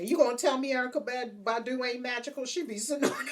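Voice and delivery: ordinary delivery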